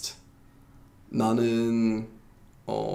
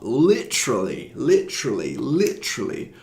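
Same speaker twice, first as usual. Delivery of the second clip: mock overuse; slower than usual. Teacher mimicking how Brits constantly say it, mocking overuse. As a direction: mimic the overused word with swooping pitch. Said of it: "It's constantly used".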